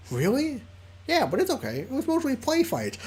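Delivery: squeaky Liam voice. Acted. Squeaky Liam shrugging off the fights. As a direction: let the squeak climb. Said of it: "the squeaky voice I do for Liam".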